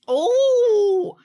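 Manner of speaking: sudden high loud yelp of discovery; surprised oh. Real, not acted. Streamer spotting a new train, surprised yelp. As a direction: blurt it out the moment you spot it. Finds something while checking.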